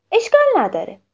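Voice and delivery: high, bright no-problem; lightly voiced child; cheery reassurance. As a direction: brush it off cheerfully. Miko reassures sad friend.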